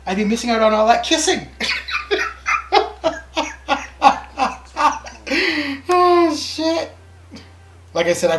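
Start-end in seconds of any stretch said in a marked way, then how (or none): 0.1-1.5 louder, higher, cracking up; laughing punchline. Acted. Delivers punchline, breaking into laughter. 1.5-5.8 long, high cackling laugh; real belly laugh. Real, not acted. Cracks up at own joke. 5.9-6.9 loud, high, through laughter; real laughing. Real, not acted. Recovering from laughing at joke.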